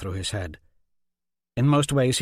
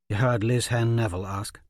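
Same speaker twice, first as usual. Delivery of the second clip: ordinary delivery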